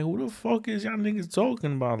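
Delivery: high, disbelieving retelling; incredulous retort. Retold or imitated. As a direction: throw it back in disbelief. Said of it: "I'm like".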